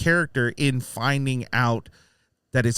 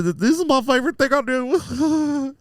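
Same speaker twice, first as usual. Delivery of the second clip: put-on higher, louder babyish gush; babyish Peter; 11 semitones higher, 4 dB louder than usual. Retelling Peter talking to Tinkerbell like a baby, higher and giddy. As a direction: play him like a giddy baby. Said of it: "he's like a baby again".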